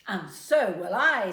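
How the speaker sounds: Granny Suze not to be outdone; competitive reply. A narrator's reading. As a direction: top the other granny.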